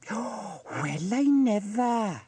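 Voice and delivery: high, drawn-out gossip's astonishment; scandalised busybody. A narrator's reading. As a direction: marvel slowly, thoroughly shocked. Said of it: "she went on".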